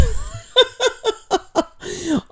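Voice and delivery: high, staccato giggling fit; burst of laughter. Real, not acted. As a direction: break into a long high laugh. Laughing at a viewer's comment.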